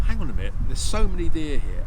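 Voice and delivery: loud mock outrage at all the deer; bursting out, fed up, loud; half-comic exasperated outburst; exasperated inner protest.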